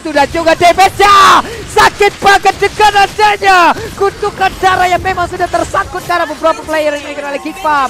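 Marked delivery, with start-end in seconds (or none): 0.0-6.6 excited shout. Real, not acted. Caster reacts to big damage.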